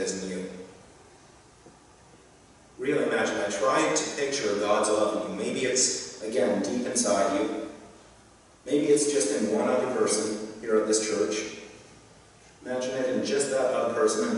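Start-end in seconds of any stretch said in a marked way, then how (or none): none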